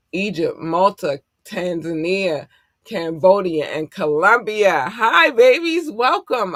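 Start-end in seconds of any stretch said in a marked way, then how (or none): 4.9-6.6 high, loud, drawn-out greeting; gushing welcome. Real, not acted. Streamer welcoming listeners from new countries, high gushing greeting. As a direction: squeal a slow, delighted hello.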